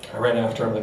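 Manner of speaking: ordinary talk, nothing marked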